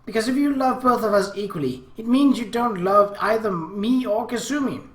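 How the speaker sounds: high, loud hurt little-sister voice; sobbing. A narrator's reading. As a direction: argue as an upset younger sister, lightly acted sobs. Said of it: "Akemi sobbed".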